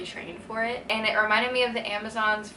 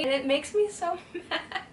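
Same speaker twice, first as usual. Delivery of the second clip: laughing; 7 semitones higher than usual. Real, not acted. Laughs while venting frustration.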